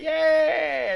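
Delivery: high, loud mocking "Yeah"; mock yell. To imagined viewers, a loud mocking yeah. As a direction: bellow it in mock triumph.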